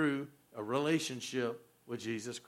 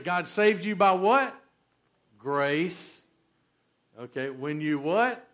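ordinary delivery

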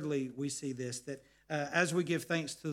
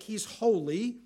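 ordinary talk

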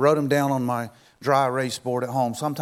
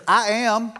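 higher, louder, commanding "I am"; emphatic declaration; 7 semitones higher, 5 dB louder than usual. Acted. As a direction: declare it with God's authority. God answers the panicking people.

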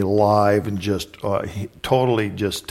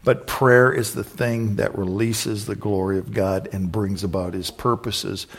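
ordinary talk

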